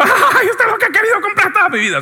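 high, gushing over the item; mock gushing buyer. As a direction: overeager buyer in love with the item. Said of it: "Y no miro como".

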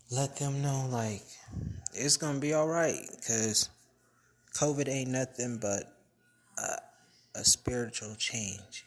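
excited. Real, not acted. Rousing encouragement to listeners.

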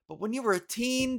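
ordinary talk, nothing marked

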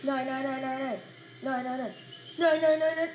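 panicked cries under attack; scared horror game streamer. Real, not acted. Enemy closing in, panic.

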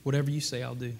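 soft, meek reply; a gentle, yielding answer to Naomi; humble and gentle. Acted. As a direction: say it softly, with humble grace. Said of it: "she just flows with humility and with grace".

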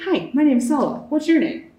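chirpy introduction. Retold or imitated. Student introducing themself to people in school, chirpy and forward. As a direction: introduce yourself brightly to a stranger.

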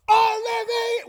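very high, loud name call; hollering dad. Retold or imitated. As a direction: bellow the name as through cupped hands. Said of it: "two hands over the mouth".

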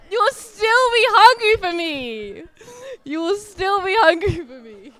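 high, drawn-out preaching shout, easing on the repeat; impassioned cry. Real, not acted. Preacher voicing God's promise to the congregation, impassioned high cry. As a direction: call it out with overflowing emotion.

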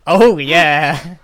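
loud, booming mock cheer; big mock-enthusiastic shout; hammed-up exclamation. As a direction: belt it out as an over-the-top catchphrase. Mimics listeners, loud and hammed.

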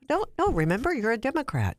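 coaxing mom. Retold or imitated. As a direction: gently persuade her, mock-patient.

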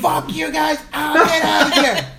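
high-pitched mock rage; acted pained tirade; furious shouting. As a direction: chase off useless helpers. Acted outburst at relatives.

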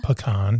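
low pecan, puh-KAHN; repeated demo. Shown as a demonstration. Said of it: "come up with the four ways".

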